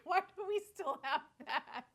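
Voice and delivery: high, loud, laughing through the words; laughing mock question. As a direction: laugh it out in disbelief. Mocking retold own remark, laughing.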